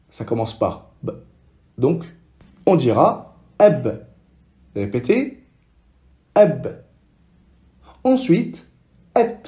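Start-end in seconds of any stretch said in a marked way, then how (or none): 3.6-4.1 ab with only the start of the b, lips closed and unreleased; Arabic b with sukun, cut short. Shown as a demonstration. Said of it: "on prononce que les débuts du ba". 6.3-6.8 ab with only the start of the b, lips closed and unreleased; Arabic b with sukun, cut short. Shown as a demonstration. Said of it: "on prononce que les débuts du ba".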